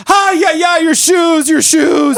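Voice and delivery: high, loud excited cry about shoes; urgent high-pitched host alarm; excited shout. Retold or imitated. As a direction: call out high and loud to stop them at the door. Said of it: "she comes around and she goes".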